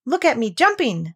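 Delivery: lightly voiced squeaky youngster; excited squeak. A narrator's reading. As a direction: pipe it up like a small child. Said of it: "squeaked Roo".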